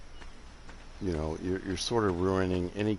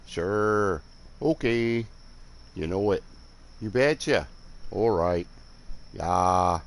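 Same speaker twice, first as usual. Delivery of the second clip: put-on cheerful, agreeable Northern Midwest accent; light mock Northern Midwest accent; slower than usual. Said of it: "I'll do my best impression".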